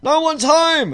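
mock answer. Captain joking nobody's home, squeaky loud voice. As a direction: play the captain pretending he's out. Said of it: "And he's like".

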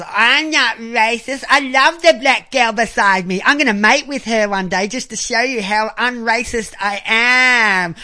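lightly voiced sneering teen impression; mocking teen voice. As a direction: parody a dim teenager proudly declaring himself open-minded. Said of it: "he's gonna be one of these kids that's".

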